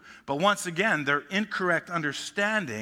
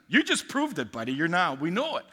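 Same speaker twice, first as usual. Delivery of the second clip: mocking taunt; 3 semitones higher than usual. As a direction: toss it off with a scoffing grin.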